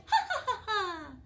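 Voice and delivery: high, loud cackling laugh; delighted witch cackle. Acted. Witch cackles at news of the pet show, delighted. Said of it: "cackled with delight".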